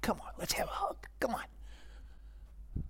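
hushed, unvoiced whisper, coaxing like a softie; mock a God who only wants hugs, whispered and breathy-soft; whispered parody of an indulgent God beckoning sinners for a hug. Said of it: "and he just goes".

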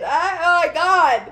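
ordinary delivery

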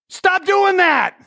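high, slow mock bark; blunt mock advice. Pastor mocks his own blunt counseling, slow high bark. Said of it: "something stupid like".

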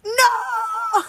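put-on high shouted "No!" in a British accent; a sudden British-accented protest; Harry, British accent. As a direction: cry out "No!" like an offended British boy. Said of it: "You said it with a British accent".

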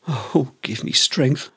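a tired plea to the heavens; a slow, deflated sigh of despair; weary exasperation. Real, not acted. Exasperation at buzzwords.

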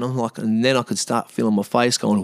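normal talking voice

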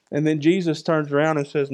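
normal talking voice

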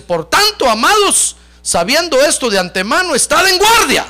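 high, loud preacher's proclamation; forceful scripture reading. Acted. Preacher warns congregation from Peter's letter, forceful proclamation. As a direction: ring out the verse as a warning.

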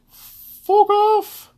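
squealed, defiant brush-off. Real, not acted. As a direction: fling it out in a high squeal. Defiant dismissal.

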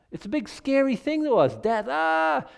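high, rising mock dread; a preacher hamming up fear of death; mock fearful. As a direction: play up the scariness of death.

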